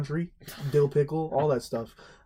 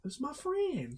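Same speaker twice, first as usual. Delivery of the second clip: meek high excuse; 13 semitones higher, 6 dB quieter, much slower than usual. Retold or imitated.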